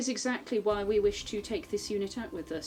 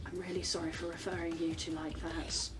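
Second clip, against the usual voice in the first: stage whisper; 3 semitones lower, 7 dB quieter than usual. Acted. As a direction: whisper it so others can still hear. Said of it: "I'm gonna very quietly whisper to you, obviously stage whisper".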